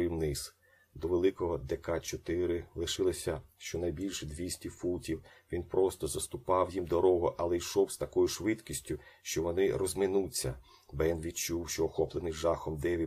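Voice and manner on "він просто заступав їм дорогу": ordinary delivery, nothing marked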